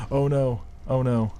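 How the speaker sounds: alarmed. Real, not acted. Crashed airship into island.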